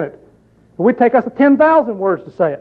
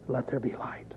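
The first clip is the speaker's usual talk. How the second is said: whisper; 8 semitones lower, 20 dB quieter than usual. Acted. God's words quoted in sermon.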